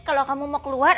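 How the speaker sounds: usual speaking voice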